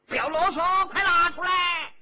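loud, high barked order; officer shouting at the dwarf; angry shout. A narrator's reading. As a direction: yell it at him. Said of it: "喝道".

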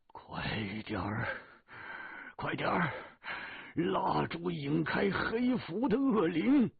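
urgent plea building to a loud, high cry; anxious urging. A narrator's reading. Elder urging companions to draw off evil spirits, anxious. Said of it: "着急地说".

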